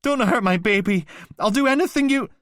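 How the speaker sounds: very high, loud begging scream; desperate pleading. Acted. Mother on the recording begging the attacker, screaming. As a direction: plead for the child's life.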